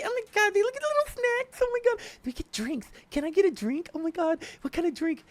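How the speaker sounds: squealing high giddy wife impression; excited wife. Husband mimics wife thrilled by plane snacks, squealing high. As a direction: gush over the snacks like a thrilled foodie.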